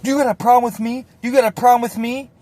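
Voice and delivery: high, fast backpedaling questions; high anxious junkie voice; high nervous mock. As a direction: sound like a scared tough guy checking. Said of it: "He's like".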